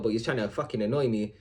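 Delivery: ordinary delivery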